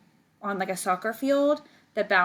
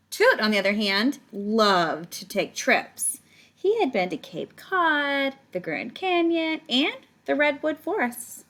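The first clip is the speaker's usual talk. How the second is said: higher, lilting storybook read; story reading; 5 semitones higher, 3 dB louder than usual. Acted. Teacher reading Toot's travels to the class, lively storybook voice. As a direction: lift it up, savouring each place name. Teacher reads picture book aloud.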